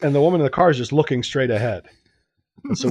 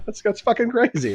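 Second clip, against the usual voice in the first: amazed; 10 semitones higher than usual. Retold or imitated. Retold amazement at app.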